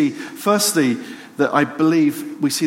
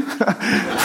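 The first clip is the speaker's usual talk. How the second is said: amused laugh; 7 semitones higher than usual. Real, not acted. Laughs at the dog's return.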